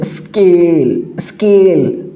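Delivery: "स्केल" slowly, with a long drawn-out vowel; syllable demonstration. Shown as a demonstration. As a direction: stretch the ए in "स्केल" to show how it sounds. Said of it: "जैसे आप स्केल बोलते हैं". ("स्केल" को खींचकर धीरे बोलना)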